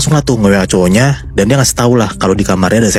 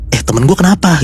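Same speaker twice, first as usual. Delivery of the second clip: raised, mildly alarmed quote; lightly acted alarm, pitched up; mock panic; 6 semitones higher than usual. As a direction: imitate a worried friend. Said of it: "teman-teman tuh panik kayak".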